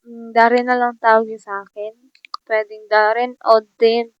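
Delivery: usual speaking voice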